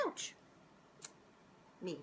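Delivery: ordinary delivery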